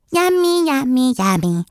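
put-on high babyish sing-song, yummy yummy; a toddler gleefully chanting. Toddler Fudge chants happily.

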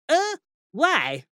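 high, bewildered little question; a timid old wizard blurting it out; puzzled lecturer. A narrator's reading. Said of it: "said the lecturer in recent runes".

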